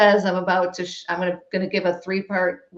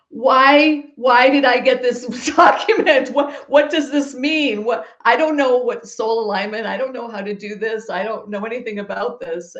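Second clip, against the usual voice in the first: mock distraught wail, higher and loud at first, then easing; retold sobbing; 4 semitones higher, 5 dB louder than usual. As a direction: act out a bewildered, sobbing plea without real tears. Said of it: "I'm sobbing and I'm like".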